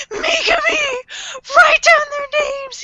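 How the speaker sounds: high-pitched mock wailing plea; hammed-up tearful cry for help; imitated crying out. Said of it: "he's crying to Mikami".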